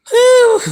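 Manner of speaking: exasperated high whistle-like whew; loud high whew. Real, not acted. Vlogger reacting to the comments, loud high exasperated whew. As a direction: blow it out in disbelief.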